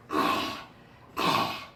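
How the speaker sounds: airless, strangled choking gasps. Acted. Preacher choking at dinner, breathless gasping. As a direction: gasp like an old man choking on chicken. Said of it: "and he was choking".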